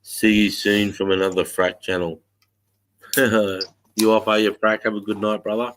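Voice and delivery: ordinary delivery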